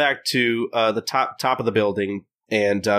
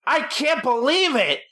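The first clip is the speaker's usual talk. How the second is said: much higher, slow hammed-up rant; mock outraged yell; 14 semitones higher, slower than usual. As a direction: wail it like a grumpy old man at the world. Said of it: "he just starts yelling".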